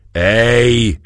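drawn-out outraged exclamation; angry bellow. Acted. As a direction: roar it out as the sword is drawn. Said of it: "ordította Bódogfalvi a kardját kirántva".

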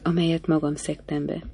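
ordinary delivery, nothing marked

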